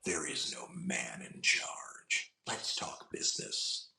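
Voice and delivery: very slow, low villain whisper; Ulysses Klaue. Acted. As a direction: whisper it slowly with quiet menace. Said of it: "Ulysses Klaue from Avengers two".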